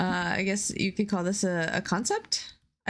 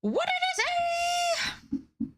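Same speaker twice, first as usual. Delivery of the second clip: squeaky question. Pretend. Squeaky high mock question.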